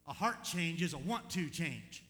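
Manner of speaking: loud, slow, emphatic preaching. Real, not acted. Pastor driving home the point, loud and slow. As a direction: land it slowly with weight.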